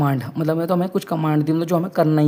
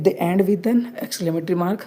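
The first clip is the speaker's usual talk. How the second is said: slow dictation; much slower than usual. Shown as a demonstration. Teacher dictating definition to class.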